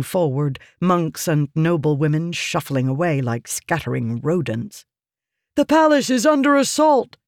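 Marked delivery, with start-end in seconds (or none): 5.5-7.2 high, loud panicked shout; alarmed shout. A narrator's reading. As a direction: raise the alarm, breathless. Wounded soldier brings alarm.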